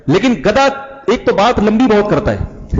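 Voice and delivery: ordinary talk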